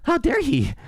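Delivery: high indignant mock whine. Streamer mocking a crybaby caller, whiny. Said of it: "do you wanna cry more?".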